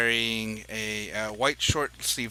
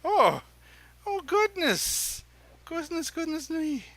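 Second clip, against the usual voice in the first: much higher, fluttery dandy voice; flattered dandy; 14 semitones higher than usual. Acted. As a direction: gush like a flattered fop.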